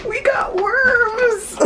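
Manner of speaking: very high, drawn-out squeal; excited. Real, not acted. New owner celebrating the worm delivery, high excited squeal. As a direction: squeal it with glee, stretching the words. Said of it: "I'm so excited about this".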